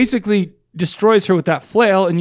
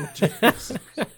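giggle; 4 semitones higher, 9 dB quieter than usual. Real, not acted. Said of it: "I'm, like, laughing".

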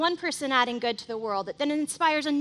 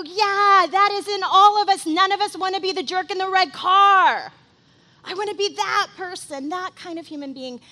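inspired exclamation; 6 semitones higher, 9 dB louder than usual. Retold or imitated. Voicing inspired onlookers.

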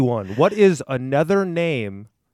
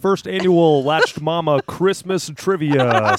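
big booming announcer welcome; game show host voice. Acted. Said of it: "Is that your game show host voice".

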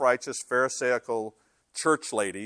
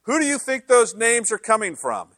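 emphatic retelling; 7 semitones higher, 9 dB louder than usual. Retold or imitated. God's voice retold, louder.

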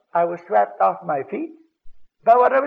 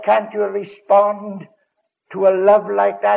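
slow quoted appeal; 5 dB louder than usual. Retold or imitated. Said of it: "when you said".

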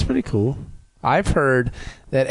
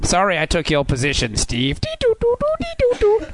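toy robot voice; 4 semitones higher than usual. Pretend. Robot Picard to Steve.

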